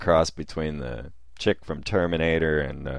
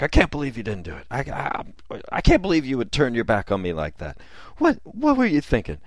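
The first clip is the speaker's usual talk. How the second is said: mock accusing rant; 7 semitones higher than usual. Storming woman accuses the man, rising mock outrage. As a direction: play her hurt outrage, pitch climbing fast.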